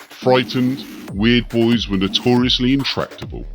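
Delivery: plain reading voice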